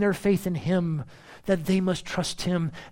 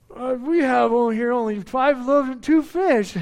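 disciples' excuse; 5 semitones higher, 5 dB louder than usual. Retold or imitated. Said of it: "notice they're like".

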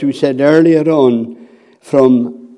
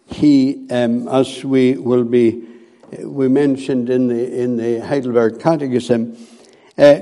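ordinary delivery

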